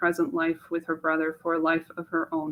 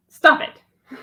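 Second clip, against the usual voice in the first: sharp self-rebuke; 8 semitones higher, 9 dB louder than usual. Acted. Mache snaps at herself to stop dreaming, louder. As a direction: Stop it, higher and louder, snapped.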